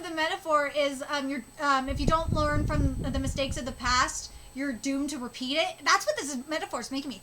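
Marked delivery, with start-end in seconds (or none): none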